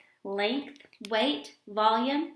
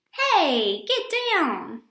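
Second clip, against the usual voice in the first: raised voice telling dogs off; calling out; 6 semitones higher, 5 dB louder, much slower than usual. Acted. Girl scolding jumping dogs in the park, raised call. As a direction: shout a quick get down at the dogs.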